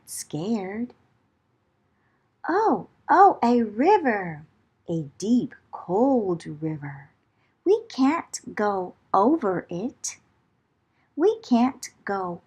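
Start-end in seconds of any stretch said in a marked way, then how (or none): none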